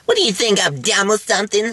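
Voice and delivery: high, squeaky dim-witted actress; dumb starlet voice. Retold or imitated. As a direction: whine it like a clueless diva. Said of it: "her catchphrase".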